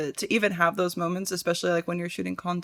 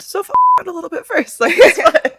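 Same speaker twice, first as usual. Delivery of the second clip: giggly high; 11 semitones higher, 11 dB louder than usual. Real, not acted. Joking advice, high laugh.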